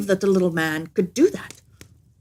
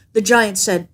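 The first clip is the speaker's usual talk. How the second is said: plain reading voice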